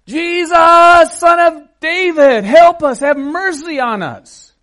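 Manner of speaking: high, loud cry for mercy; screaming plea. Acted. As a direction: scream for help as the desperate blind beggars. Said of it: "they start screaming".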